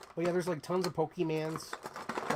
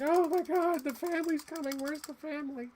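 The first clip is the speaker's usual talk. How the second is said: much higher, frantic cartoon voice; panicky cartoon cat; 14 semitones higher than usual. Wind-up Cat in the Hat toy panicking about the family, squealing high. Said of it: "he's supposed to, like, jump around like".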